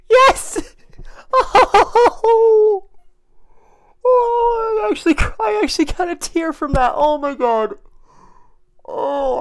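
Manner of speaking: high, loud tearful cheering; real happy tears breaking through; tearful joy. Real, not acted. Viewer overjoyed at the post-credit scene, tearful cheering. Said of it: "I'm actually starting to cry".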